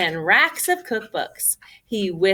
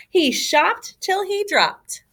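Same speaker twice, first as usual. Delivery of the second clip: plain reading voice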